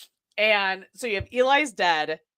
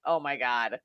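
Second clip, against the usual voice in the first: disgusted mutter; 9 semitones lower, 8 dB quieter than usual. Retold or imitated. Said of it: "I'm like".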